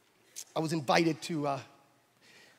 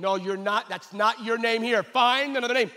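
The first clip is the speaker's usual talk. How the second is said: louder, higher insistent refusal; animated officer; 5 semitones higher, 4 dB louder than usual. Retold or imitated. Officer getting animated with Israel, louder refusal. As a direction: get worked up and refuse. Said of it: "getting animate over there and going".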